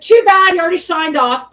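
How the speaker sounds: high, loud opening that eases off; dismissive retort as Lars. Retold or imitated. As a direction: snap back with mocking, loud dismissal. Acts out Lars's dismissive reply.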